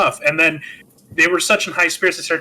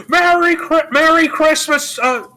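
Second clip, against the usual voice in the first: joyful Christmas greetings; 9 semitones higher, 3 dB louder than usual. Retold or imitated. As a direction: call out to every building like an overjoyed film hero. Hero greeting the town joyfully.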